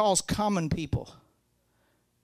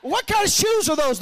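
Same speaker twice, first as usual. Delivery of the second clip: high, loud, wide-eyed amazement; high impressed question; 13 semitones higher, 6 dB louder than usual. Retold or imitated. Churchgoer admires the pastor's shoes, high and amazed. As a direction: ask it squealing with admiration. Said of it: "they're like".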